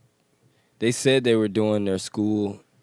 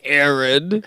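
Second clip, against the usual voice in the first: raised, mock-accusing imitation of being singled out; mocking quote of a call-out; 6 semitones higher, 4 dB louder than usual. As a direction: mimic a pointed name call, higher pitched. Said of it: "Oh, you was like".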